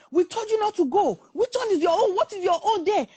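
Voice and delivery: high-pitched, fed-up telling-off; exasperated scolding. Retold or imitated. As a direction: imitate children scolding their mother. Children scold mother for intervening.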